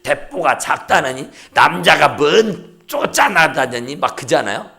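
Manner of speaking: ordinary delivery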